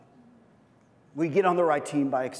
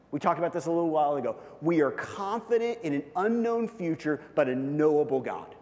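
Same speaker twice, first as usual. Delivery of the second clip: normal talking voice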